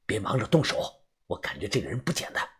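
low, hushed and quick; quiet aside. A narrator's reading. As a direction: murmur it under your breath. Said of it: "皱眉小声地说道".

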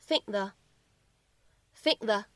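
"think the" with the k held, not released; unreleased t linking. Shown as a demonstration. Teacher showing students how "think the" links, slow. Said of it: "我们可以读成".

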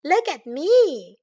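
high, loud call for attention; crying out. A narrator's reading. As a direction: call out like an excited little bear. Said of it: "cried Baby Bear".

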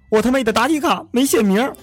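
high, sudden dismayed cry; shocked realization. Acted. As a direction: blurt it out in horror. Student suddenly realizes blunder.

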